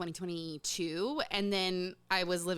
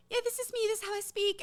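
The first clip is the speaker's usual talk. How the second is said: mock British accent; 13 semitones higher, faster than usual. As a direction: lightly parody a put-on British accent. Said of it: "[name] suddenly showed up with a British accent".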